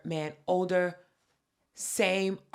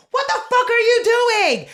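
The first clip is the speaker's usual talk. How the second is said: shrieking Karen, much higher and louder; furious customer yelling at the post office clerks; Karen mode; 11 dB louder than usual. Retold or imitated. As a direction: scream it like an entitled Karen in line. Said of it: "loses her shit. Full-blown Karen mode".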